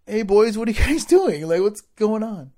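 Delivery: lightly voiced mom's call. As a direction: imitate a mom checking on the boys. Mom calling to the boys.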